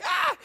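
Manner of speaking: high mock scream of fright; sudden high-pitched "ah"; startled scream. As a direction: yelp as if you saw a ghost. Imagines himself seeing the ghost.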